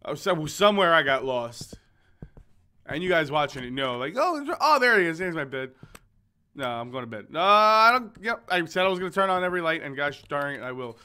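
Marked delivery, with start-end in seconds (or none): 4.2-5.7 mock excited viewer. Viewers spotting the bed before the player, mock excitement. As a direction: imitate an excited onlooker spotting it.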